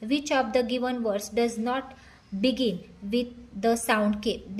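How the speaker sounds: each English word spaced out very slowly; slow dictation reading. Shown as a demonstration. Teacher reading the question to students, very slowly.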